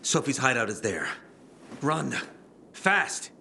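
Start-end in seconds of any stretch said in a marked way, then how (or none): 1.8-3.3 urgent shout. Acted. Barney urging Aiden to run to the hideout, shouting. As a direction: bark the order, building to a shout.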